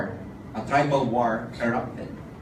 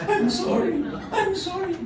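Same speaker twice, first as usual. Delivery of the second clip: high, laughing fake sobbing apology; badly faked tearful sorry; mock crying wife. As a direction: whimper it like a guilty wife, hammed up. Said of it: "the wife was crying like".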